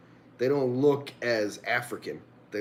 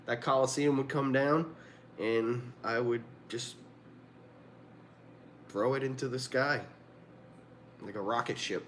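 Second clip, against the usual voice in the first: normal talking voice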